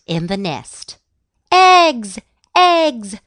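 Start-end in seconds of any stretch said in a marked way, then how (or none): 1.5-3.2 high, loud repeated cry; excited shout. A narrator's reading. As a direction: shout each word with delight. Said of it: "she shouted".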